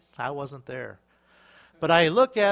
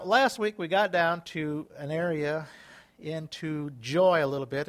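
usual speaking voice

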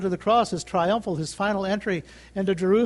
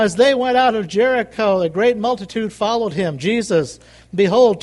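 normal talking voice

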